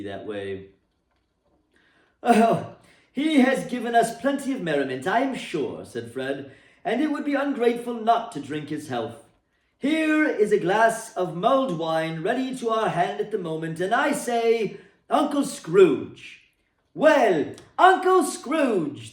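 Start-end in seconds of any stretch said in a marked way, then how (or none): 2.2-16.4 a lightly voiced, higher, louder hearty nephew; hearty toast. A narrator's reading. Fred toasting his absent uncle at the party, hearty. 16.9-19.1 high, loud, slow toast cry; cheering toast. A narrator's reading. Guests crying out the toast to Scrooge, high and loud. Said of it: "they cried".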